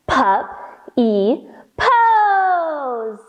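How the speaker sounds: very slow, sing-song bouncing words; bouncy stretched puppy pose. Shown as a demonstration. Teacher bounces the kids through puppy pose, very slow sing-song. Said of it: "And one more time".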